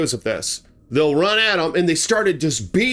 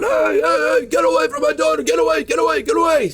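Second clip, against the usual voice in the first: frantic father yelling; 12 semitones higher, faster than usual. Retold or imitated. Father yelling at the bear to leave his daughter, high frantic shout. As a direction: yell at the bear to back off from your daughter.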